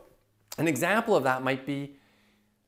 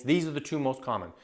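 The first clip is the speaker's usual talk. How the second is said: normal talking voice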